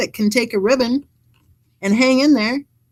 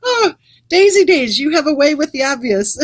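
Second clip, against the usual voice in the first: said through real laughter, louder; laughing; 5 semitones higher, 4 dB louder than usual. Real, not acted. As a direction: laugh the words out. Laughing at Daisy's comment.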